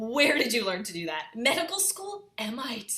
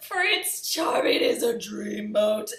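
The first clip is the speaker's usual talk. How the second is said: dreamy swoon; slower than usual. Acted. Gasp over Prince Charming.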